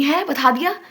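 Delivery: high, loud disbelieving gasp of a question; as if caught out by surprise; shocked. Retold or imitated. Girl learning her friend told everything, shocked.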